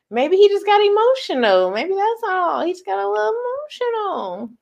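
high, sing-song cooing excuse; playful excusing. Real, not acted. Excusing a man crying over her, playful coo. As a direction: pitch it way up, drawing out the last word.

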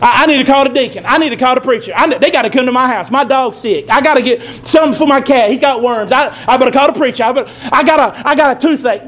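rushed, fussy imitation of a worried churchgoer; mock fretting, quick; hurried mock panic. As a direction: rattle off excuses to call the preacher. Mocking panicky church members.

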